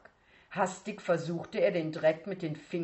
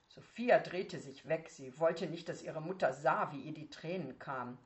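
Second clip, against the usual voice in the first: usual speaking voice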